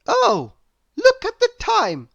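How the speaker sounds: high, loud startled cry; cried out. Acted. Little Miss Whoops crying out at the late hour, high and loud. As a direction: exclaim in sudden alarm. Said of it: "cried Little Miss Whoops".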